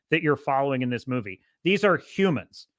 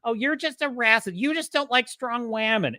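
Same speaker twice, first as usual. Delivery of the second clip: mocking critics; 6 semitones higher than usual. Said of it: "all this gaslighting".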